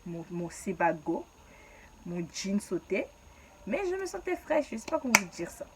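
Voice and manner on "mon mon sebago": ordinary delivery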